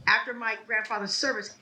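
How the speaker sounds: ordinary talk, nothing marked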